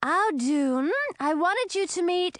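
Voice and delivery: put-on high-pitched young female dragon; trying again. A narrator's reading. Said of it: "Hildy tried again".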